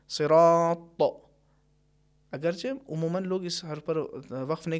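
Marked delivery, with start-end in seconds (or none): 0.1-1.0 صراط ending in a bouncing, echoing ط; qalqala on ta. Shown as a demonstration. Teacher demonstrating qalqala when stopping on ta. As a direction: stop on صراط and let the ط bounce. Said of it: "جیسے".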